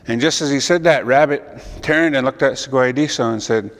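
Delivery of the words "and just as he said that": usual speaking voice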